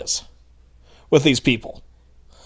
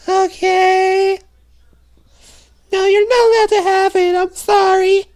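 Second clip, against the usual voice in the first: much higher put-on voice of a smug lecturer; mocking impression; 13 semitones higher than usual. Vlogger mocks a gun-control critic's supposed real voice, much higher and squeaky. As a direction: squeak it out like a prissy scold. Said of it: "I'll do an impression of him".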